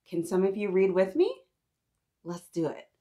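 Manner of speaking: plain reading voice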